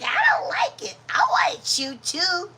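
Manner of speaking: high, loud jealous whine; jealous dog. Pretend. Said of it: "She's like".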